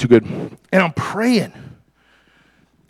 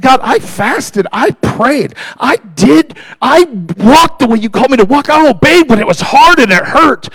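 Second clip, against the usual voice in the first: high, building, emphatic complaint; impassioned protest; 8 semitones higher, 5 dB louder than usual. Retold or imitated. Man protesting to God that he obeyed, impassioned and high. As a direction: list each sacrifice harder, building to loud.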